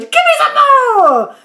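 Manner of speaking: high, loud crone's cry of glee; old woman shouting. A narrator's reading. Grandma shouting with joy as she grows upward. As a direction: belt it out like a giddy old grandma. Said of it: "she cried".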